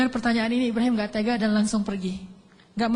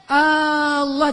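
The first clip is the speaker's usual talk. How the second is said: usual speaking voice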